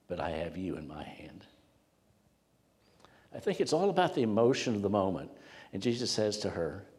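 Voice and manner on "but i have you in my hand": whispered, tender assurance; soft whisper; reassuring whisper. Acted. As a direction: hush it like a promise. Preacher voices Jesus' reassurance.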